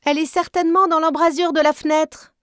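triumphant little sister shouting; girl crying out. A narrator's reading. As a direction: shriek it out to give her away. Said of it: "s'écria".

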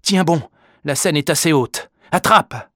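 high, loud encouragement; urgent calling out. A narrator's reading. Father to son in the river, urgent calling out. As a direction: call out firmly to someone in danger.

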